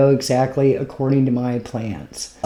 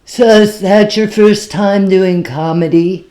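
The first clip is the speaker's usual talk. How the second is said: imitating the drunk guy; 6 semitones higher, 6 dB louder than usual. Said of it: "The worst one was".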